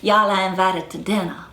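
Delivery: non-rhotic plantation Southern accent, R dropped; non-rhotic Southern accent demo. Shown as a demonstration. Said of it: "So they'll say".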